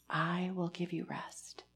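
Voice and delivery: slow hushed whisper; whispered repeat. Real, not acted. Pastor whispering the verse's promise to the weary. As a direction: let the promise sink in softly.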